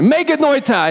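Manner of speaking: high-pitched, forceful Greek no; emphatic exclamation, pitch jumping up; emphatic negation. Shown as a demonstration. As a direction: proclaim it as an absolute refusal. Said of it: "It's an emphatic negation".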